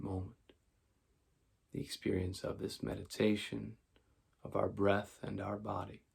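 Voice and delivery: calm, measured meditation voice with long pauses; guided meditation. Acted. Teacher leading listeners toward sleep, calm guided meditation. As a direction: guide them gently through breath and body.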